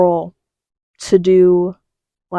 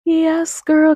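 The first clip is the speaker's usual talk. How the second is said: excited cheer; 9 semitones higher than usual. Retold or imitated. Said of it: "I'm like".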